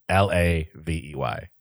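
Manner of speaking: normal talking voice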